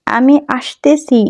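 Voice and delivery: slowed, emphatic teaching voice; slow review line; slow repeat. Shown as a demonstration. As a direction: repeat it slowly for viewers. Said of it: "আমি আবারও বলে দিচ্ছি".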